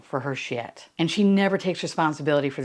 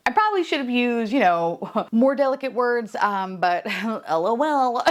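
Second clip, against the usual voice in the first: higher, giggly half-apology; mock sheepish giggle; 5 semitones higher than usual. Lindsay brushing off her words to producers, giggling. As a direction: laugh it off.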